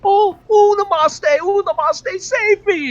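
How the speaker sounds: high, loud frantic pleading; panicked cries for help. Acted. Game master voices a man crying to be saved, panicked and high.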